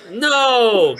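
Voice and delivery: loud, high burst; protesting no. Real, not acted. Reader protesting her friend quitting the book, loud. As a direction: shout no to stop them.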